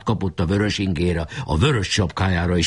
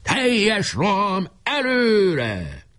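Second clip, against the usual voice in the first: slow, high charge cry; shouted command; 12 semitones higher, much slower than usual. A narrator's reading. Leader orders the final charge, long high cry. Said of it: "kiadta az utolsó parancsot".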